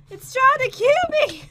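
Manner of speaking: high, loud panicked exclamation; alarmed cry. Real, not acted. Player being attacked in the game, alarmed cry. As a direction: cry out under sudden attack.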